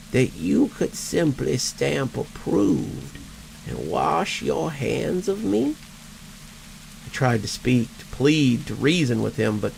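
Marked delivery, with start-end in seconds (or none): 0.1-5.8 slow, wheezing undead voice; a dry, rasping dead man; wheezing ghost. Acted. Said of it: "his voice like dry leaves skittering".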